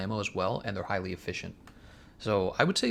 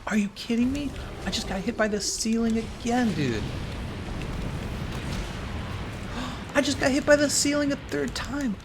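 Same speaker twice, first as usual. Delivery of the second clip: exasperated outburst; 10 semitones higher than usual. Real, not acted. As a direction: yelp it in real exasperation. Hit by the ceiling again.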